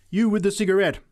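high, loud singling-out; accusing cry. A narrator's reading. Preacher points at the smoker, loud accusing cry. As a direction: call out the sinner. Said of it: "cried the preacher".

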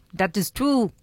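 mock Russian cartoon-spy accent; a light Boris-and-Natasha Russian accent. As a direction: do a hint of a Russian cartoon villainess. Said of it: "you sound a little bit more like Natasha from Rocky and Bullwinkle".

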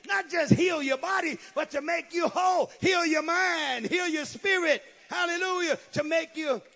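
high, loud preacher's cry; impassioned pulpit shout; fervent preaching. Real, not acted. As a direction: drive each phrase home like a revival preacher. Preaches at full force.

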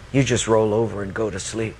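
low, even, gentle reproof; God speaking. Acted. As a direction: turn the complaint back in a low, calm voice. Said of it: "then God spoke to me".

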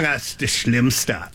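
"das Schlimmste" slow and careful, German sch and short i; German phrase demo. Shown as a demonstration. Showing the band how to use "das Schlimmste", slow careful German. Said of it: "you could have just said".

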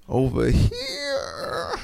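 high, drawn-out, mock strained groan; straining on the toilet. Comic showing the audience a dude on the toilet, straining. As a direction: stretch HERE out as if pushing hard.

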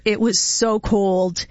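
ordinary talk

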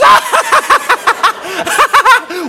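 high, rapid-fire cackle; imitated uncontrollable laughing fit; mocking girlfriend laugh. Girlfriend laughs instead of answering him, high helpless cackle. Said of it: "And she goes".

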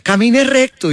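orden más aguda, imitando a la doctora; doctora imitada. Retold or imitated. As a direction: manda como la doctora.

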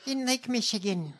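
over-the-top politician parody; hammed-up mimicry of a rehearsed anecdote; Haley Stevens. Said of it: "You sound insane".

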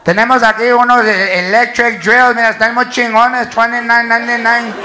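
loud Chicano store intercom pitch; store PA with accent. Acted. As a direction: announce drills over the PA, Chicano style. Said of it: "announcements have accents".

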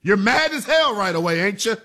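normal talking voice

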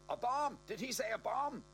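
high panicked crowd cry. Acted. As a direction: shriek it in panic.

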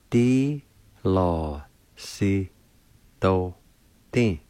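"Di lo si do din" stretched slowly, one syllable at a time. Shown as a demonstration. Teacher slowly sounding out platform, syllable by syllable. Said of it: "is pronounced like this".

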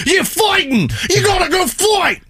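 very high, shouted cartoon brawler; hammed-up battle cry in a put-on accent; Russell Crowe impression. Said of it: "he channeled his Russell Crowe from South Park episode".

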